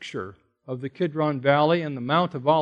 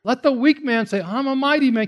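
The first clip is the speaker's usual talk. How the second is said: high mocking brag; sarcastic mock bravado; sarcasm; 8 semitones higher than usual. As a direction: ham up the weak man's boast. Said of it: "this is like sarcasm".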